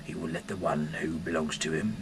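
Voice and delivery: ordinary talk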